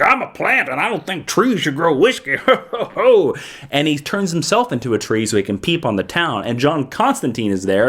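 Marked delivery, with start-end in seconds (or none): 0.0-2.4 goofy higher plant-creature voice; Swamp Thing. Acted. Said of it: "Swamp Thing shows up and he's like". 2.4-3.4 hammy high "ho ho" in character; put-on monster laugh; creature chuckle. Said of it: "Swamp Thing shows up and he's like".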